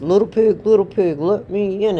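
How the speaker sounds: storybook wolf, loud and insistent; demanding wolf. Acted. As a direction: call through the door as the wolf.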